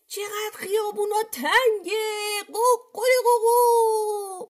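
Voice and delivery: very high rooster cry into a long crow; shouting rooster. A narrator's reading. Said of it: "داد میکشید". (داد زیر و کشیده خروس)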